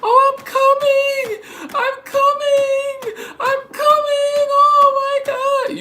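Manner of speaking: very high, drawn-out falsetto squeal; mock a woman's climax in slow, squealed wails; imitating screaming woman. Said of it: "And she like, ... screaming".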